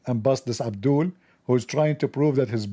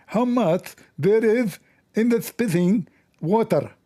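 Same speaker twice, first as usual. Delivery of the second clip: slow, higher Indian English accent like Zakir Naik; Zakir Naik impression; 4 semitones higher than usual. Shown as a demonstration. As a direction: put on Zakir Naik's Indian English accent and drag the words out. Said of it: "I will speak like a Zakir Naik".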